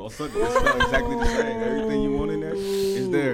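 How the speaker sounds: high laughing delivery. Real, not acted. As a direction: laugh your way through the line. Laughing at own joke.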